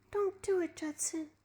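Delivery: high, soft, breathless plea; gasping plea. A narrator's reading. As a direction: gasp it out in fear. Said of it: "she gasped".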